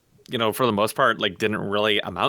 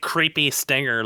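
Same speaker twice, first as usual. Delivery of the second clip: usual speaking voice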